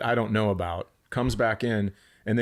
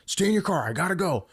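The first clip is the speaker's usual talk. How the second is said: higher, urgent repeated order; dad's hurried order; 8 semitones higher than usual. Retold or imitated. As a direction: rush it out like a dad bolting. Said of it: "he goes".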